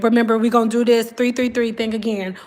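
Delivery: ordinary talk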